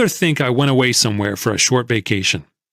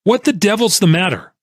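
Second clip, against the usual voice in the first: higher, sharp angry yell; an angry visitor yells at the ticker; 6 semitones higher than usual. A narrator's reading. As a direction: burst out at him in anger. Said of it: "ran to where I stood by the ticker and yelled".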